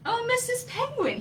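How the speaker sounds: high eager call; little bird crying out. Acted. Coco calling to Missus Penguin, high eager cry. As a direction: call out hopefully. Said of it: "he cried".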